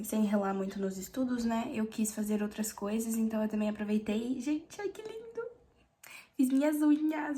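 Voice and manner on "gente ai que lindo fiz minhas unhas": excited. Real, not acted. Admiring her new nails.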